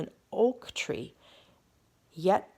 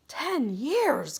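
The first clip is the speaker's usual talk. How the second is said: gasp; 9 semitones higher than usual. Acted. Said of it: "gasped the king".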